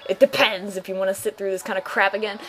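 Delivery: ordinary talk, nothing marked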